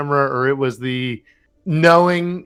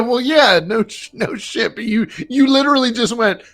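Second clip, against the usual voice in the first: laughing retort; 7 semitones higher than usual. Retold or imitated. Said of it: "And I go".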